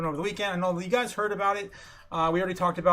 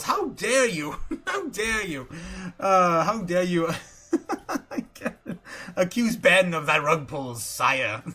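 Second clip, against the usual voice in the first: mock royal indignation; 4 dB louder, slower than usual. Mock court outrage defending Bannon.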